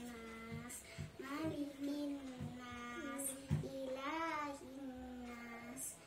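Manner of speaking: slow chanted recitation; Quran recitation. Acted. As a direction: chant the verses slowly and evenly. Reciting surah An-Nas.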